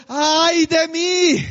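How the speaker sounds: slow wailing cry of woe; anguished cry. Retold or imitated. Prophet crying out before holy God, wailing. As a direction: cry it out as a man undone. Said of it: "es como, como un grito".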